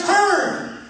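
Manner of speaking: panicked shout to turn; retold yell. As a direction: bark the warning at the driver. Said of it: "I yelled".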